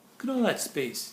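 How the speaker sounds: ordinary delivery, nothing marked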